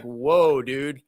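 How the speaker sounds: normal talking voice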